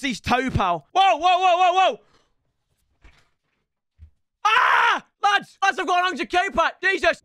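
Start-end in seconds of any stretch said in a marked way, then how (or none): 0.9-2.0 high, loud rapid-fire whoas; startled shout. Real, not acted. Gamer seeing a reward, startled shout. 4.4-5.0 high, loud shriek; excited scream. Real, not acted. Gamer winning a pack, excited scream. 5.2-7.2 high-pitched amazed shouting; excited. Real, not acted. Gamer telling the lads about his pack, excited. Announcing big pack to viewers.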